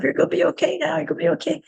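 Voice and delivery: high, gentle reassurance to herself; soothing self-talk. Retold or imitated. Calming herself before a panic attack, high and gentle. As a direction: coax yourself calm like a worried child. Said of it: "tell myself".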